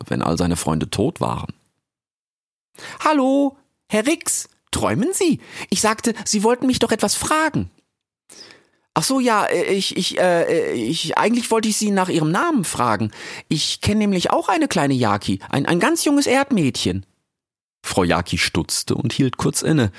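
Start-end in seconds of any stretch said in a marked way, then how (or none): 3.0-7.7 higher, lively lady's voice; Frau Jaki. Acted. Frau Jaki calls Rix. 8.9-17.0 put-on high, halting young voice; timid Rix. Rix stammering.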